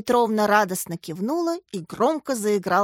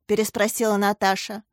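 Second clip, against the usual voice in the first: ordinary delivery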